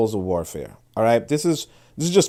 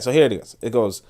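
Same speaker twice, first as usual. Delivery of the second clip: ordinary delivery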